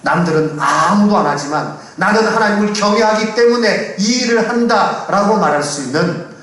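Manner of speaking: high, forceful pulpit voice; emphatic preaching. Real, not acted. Pastor urging believers to act from reverence for God, emphatic preaching. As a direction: drive the resolve home to the congregation.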